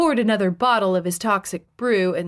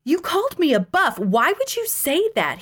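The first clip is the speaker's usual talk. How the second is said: high-pitched, indignant outrage; worked-up angry accusation; 9 semitones higher than usual. A narrator's reading. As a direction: protest in a much higher, offended voice. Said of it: "he said angrily".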